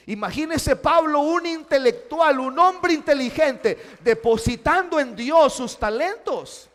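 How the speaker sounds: loud, high impassioned preaching; preaching at full force. Acted. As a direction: build it up like a pulpit climax. Preaching, raised to the crowd.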